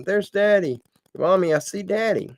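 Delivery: mild yell, pitched well up; high-pitched narrated call of a child; excited child's yell. A narrator's reading. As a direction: call out like a child spotting Daddy, without full volume. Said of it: "started yelling".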